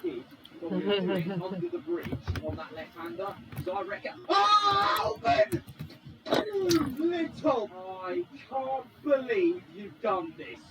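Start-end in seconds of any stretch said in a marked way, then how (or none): 4.3-5.6 high, loud yell of the name; outraged shout. Real, not acted. Player to Albon after the crash, outraged shout. 6.2-10.6 loud, slow, drawn-out disbelief; exasperated scolding. Real, not acted. Player to the driver who wrecked him, exasperated scolding.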